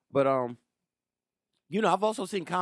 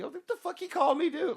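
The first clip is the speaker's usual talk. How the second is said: high, amazed replay of the insult; mock disbelief; 14 semitones higher than usual. Comic recalling a driver who yelled at him, high mock disbelief. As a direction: squeak it out in disbelief. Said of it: "you're like".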